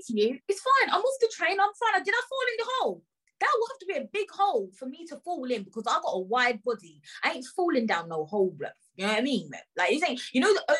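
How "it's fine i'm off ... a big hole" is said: high, rushed retold protest; exasperated protest. Passenger telling staff she is off the train, exasperated. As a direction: pitch up and insist you are fine.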